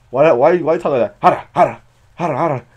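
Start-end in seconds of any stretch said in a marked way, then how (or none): 1.2-2.7 repeated rough creature grunts; imitated Pokemon grunt. Showing how the Pokemon talks, in grunts. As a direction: mimic a grunting cartoon Pokemon. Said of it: "they can only grunt".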